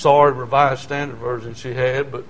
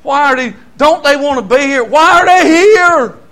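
bewildered pleading; 11 semitones higher, 10 dB louder than usual. Retold or imitated. Preacher reliving his wonder at the dry-eyed church. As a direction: plead in disbelief, building up. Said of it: "I'd wonder".

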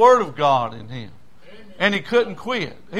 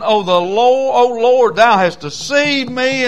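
raised, louder cry to God; impassioned complaint; 5 semitones higher, 6 dB louder, slower than usual. Acted. Jeremiah complaining to God that he was deceived, impassioned complaint. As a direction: cry out in protest.

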